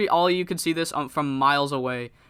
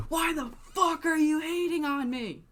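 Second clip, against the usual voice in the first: indignant high-pitched far-off shout; mock distant yell; 12 semitones higher than usual. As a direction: pretend to shout across an arena without a microphone. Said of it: "He's yelling this from a distance".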